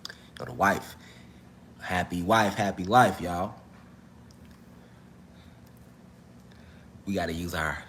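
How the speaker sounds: low, hushed murmur; inside voice. Real, not acted. Streamer to viewers about keeping the wife happy, hushed. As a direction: say it under your breath, pitched well down. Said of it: "use our inside voices here".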